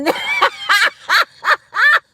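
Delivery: high squealing burst of laughter; big laugh. Real, not acted. Streamer cracking up at a viewer's gift.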